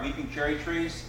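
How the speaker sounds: ordinary talk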